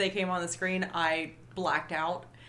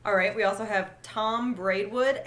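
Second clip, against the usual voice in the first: normal talking voice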